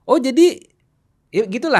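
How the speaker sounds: high, loud mock "oh so that's it"; hammed-up know-it-all; judgmental conclusion. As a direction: jump to a verdict with mock disdain.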